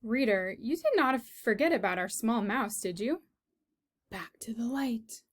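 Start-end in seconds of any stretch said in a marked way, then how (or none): 4.1-5.2 unvoiced hushed whisper; Gregory's soft whisper into the napkin. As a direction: breathe it like a secret. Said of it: "what Gregory whispered to him".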